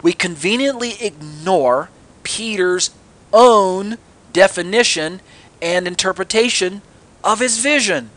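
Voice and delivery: slow, drawn-out sarcasm; sarcastic. Real, not acted. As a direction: stretch each phrase with pointed disbelief. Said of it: "sarcasm in my voice".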